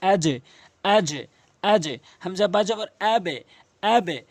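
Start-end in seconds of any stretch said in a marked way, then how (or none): 0.1-1.9 আজে with a short "e" bounce on the j; downward qalqalah. Shown as a demonstration. Teacher modelling jim for learners, downward bounce on আজে. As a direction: push the echo of আজে down to "e". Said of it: "উচ্চারণে নিচের দিকে ধাক্কা দিচ্ছি".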